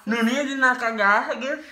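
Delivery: words mangled by a mouth opener; mouth propped open. Real, not acted. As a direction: speak with your mouth held wide open. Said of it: "vou tentar falar com o abridor de boca".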